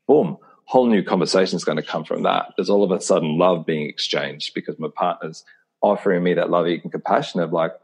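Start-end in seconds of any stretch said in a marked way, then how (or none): none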